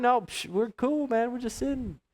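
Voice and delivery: slow, soft, easygoing reassurance; calming them. Retold or imitated. Tourist calming the panicked driver: slow soft reassurance. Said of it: "I'm like".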